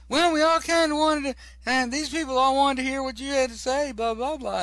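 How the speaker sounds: high-pitched comic voice of the group; mock justification in a high voice; imitated explanation. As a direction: pitch it way up, making excuses. Retold explanation from the surprise group.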